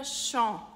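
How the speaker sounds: "champ" said "shon", nasal vowel, no P; silent P and S. Shown as a demonstration. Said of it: "Esse P e esse S são mudos". ("champ" com P mudo, vogal nasal)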